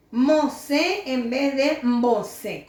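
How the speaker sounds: slow Mosé, then mbosé with mb; plain m on Mosé; plain m versus mb. Shown as a demonstration. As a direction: draw out Mosé against mbosé. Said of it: "Cómo suena bien".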